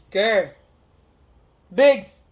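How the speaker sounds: loud re-enacted phone message; calling out. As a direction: call the names out, mock urgent.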